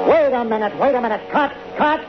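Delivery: sudden high call to stop the take; 急に高い声でカットと叫ぶ; calling cut. Acted. Director halts take over whistle.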